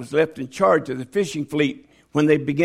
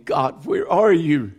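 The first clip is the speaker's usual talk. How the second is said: higher, pleading lament; desperate plea to God; 6 semitones higher than usual. Retold or imitated. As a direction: ask where God is, aching. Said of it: "That didn't stop me from saying".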